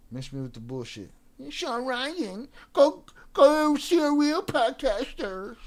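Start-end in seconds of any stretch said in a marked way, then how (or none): 1.4-5.6 high, slow sneering mimic, rising louder; mocking commenter voice. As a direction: drag out the words like a whiny heckler.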